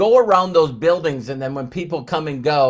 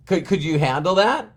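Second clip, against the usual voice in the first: ordinary delivery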